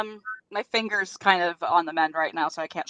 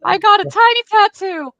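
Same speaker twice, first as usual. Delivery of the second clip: high, loud hammed-up British accent; mock British accent, much higher and louder; bad British accent; 11 dB louder than usual. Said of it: "my really bad British accent".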